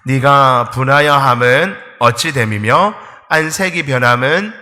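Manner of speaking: slow, loud unison scripture reading; congregation reading aloud. Shown as a demonstration. Pastor leads the church reading the red verse aloud together. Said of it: "우리 빨간색 같이 한번 읽어보겠습니다".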